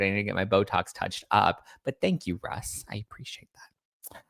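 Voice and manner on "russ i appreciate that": whisper. Real, not acted. Whispered thanks to commenter.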